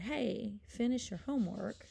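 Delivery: an attention-getting mock command. Shown as a demonstration. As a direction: call out a demonstration order to someone. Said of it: "make it into a command".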